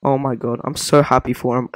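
normal talking voice

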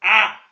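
"آ" like an alef with a fatha; short-a letter sound. Shown as a demonstration. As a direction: say "آ" as an open "ah". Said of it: "هقراها إزاي؟".

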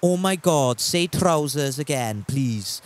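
drawn-out put-on Welsh accent. Shown as a demonstration. Streamer reads a chat comment, Welsh accent. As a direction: read it out in a slow Welsh accent. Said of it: "That's Welsh".

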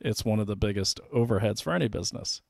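ordinary talk, nothing marked

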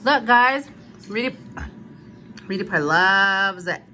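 ordinary delivery

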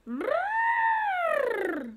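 very high loud trumpet call; elephant trumpet. Acted.

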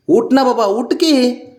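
high, pleading call to get up; high coaxing. Real, not acted. Owner coaxing the pet off the bag, high pleading.

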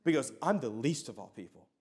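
quietly humble Paul. Retold or imitated. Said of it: "Paul could be like".

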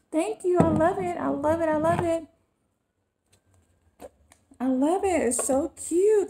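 high-pitched, cooing gratitude; delighted gushing. Real, not acted. Vlogger thanking friends for a cute gift, delighted gushing. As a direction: gush over it in a high voice.

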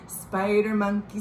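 ordinary delivery

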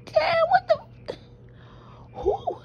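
very high squeal, laughing and crying at once; shocked squeal. Retold or imitated. Recalling her reaction to the news, squealing in shock. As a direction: shriek it in stunned disbelief. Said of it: "I was like".

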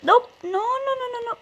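high rapid protest; urgent rising no no no; panicked no. Real, not acted. Game going wrong.

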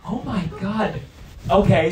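ordinary delivery

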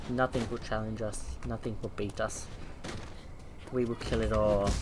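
low, quiet evil villain gloating; mad scientist. Pretend. Villain boasting, evil laugh.

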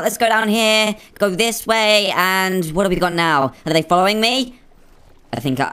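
ordinary talk

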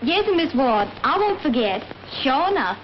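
drawled Southern maid's reply; "won't" and "enough" drawn out Southern style. Acted. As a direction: copy the Southern drawl just shown. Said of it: "That's better".

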